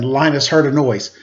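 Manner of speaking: ordinary delivery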